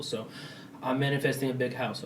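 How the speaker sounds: ordinary talk, nothing marked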